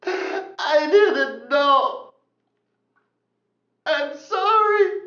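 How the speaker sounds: high, slow, tearful voice; crying out, overwhelmed by mercy; weeping. Acted. Man weeping before Peter, high tearful voice.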